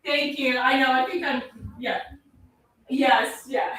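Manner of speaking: ordinary talk, nothing marked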